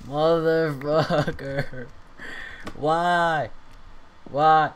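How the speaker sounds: drawn-out, loud, frustrated curse; real frustrated groaning. Real, not acted. Gamer cursing at the destroyed house, loud frustrated groan. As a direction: drag the curse out in despair.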